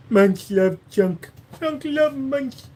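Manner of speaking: slow, squeaky cartoon voice; silly high voice.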